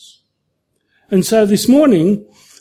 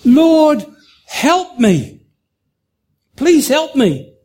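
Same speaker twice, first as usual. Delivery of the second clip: pleading for help; 6 semitones higher, slower than usual. Acted. Man hanging from a branch begs the Lord, higher and desperate. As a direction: beg the Lord to save you. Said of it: "The man said".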